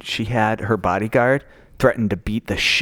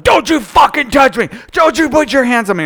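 furious shout at someone; scared and angry; 10 semitones higher, 4 dB louder than usual. Retold or imitated. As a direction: yell it like a threatening bodyguard. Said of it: "He's like".

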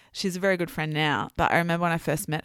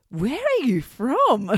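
drawn-out, louder, higher amazed question; retold astonishment; 5 semitones higher, 5 dB louder, slower than usual. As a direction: ask slowly and loudly, as if baffled by the accent. Retelling amazed first meeting with friend.

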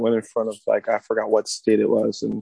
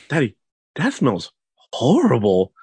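slow, drawn-out mock disgust; imitated disgusted kid; slower than usual. Son to daddy in the bathroom, exaggerated disgust. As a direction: stretch it out like an appalled child.

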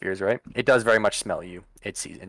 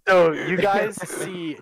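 laughing; 8 semitones higher, 4 dB louder, slower than usual. Real, not acted. GM resumes through laughter.

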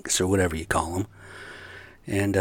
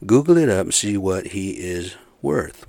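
ordinary talk

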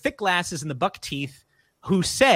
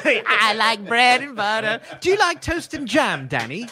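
slow, higher put-on British voice; mock director chatting to kid; 4 semitones higher than usual. Director asking kid about food, lightly mocked British voice. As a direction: coo a silly question at a child.

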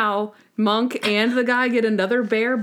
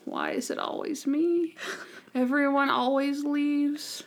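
mock depression; 6 semitones higher, 5 dB quieter than usual. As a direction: sigh it out, sorry for yourself. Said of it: "And then depression".

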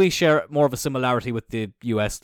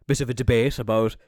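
ordinary delivery

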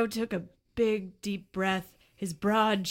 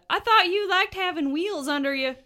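raised, bright character voice; 10 semitones higher, 9 dB louder than usual. Acted. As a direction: voice the character up high. Player voicing rancher character.

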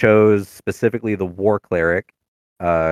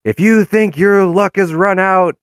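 high, loud, booming street-preacher voice; soapbox preacher; 13 semitones higher, 6 dB louder than usual. Retold or imitated. Cleric preaching to the podunk town, high and loud. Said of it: "him being on a soapbox, being like".

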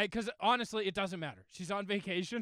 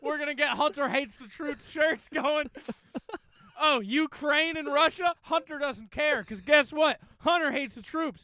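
rally the crowd in a put-on southern twang; mock southern accent; 8 semitones higher, 6 dB louder than usual. To the podcast crowd, mocking his friend in a loud southern accent. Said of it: "Why are you going southern?".

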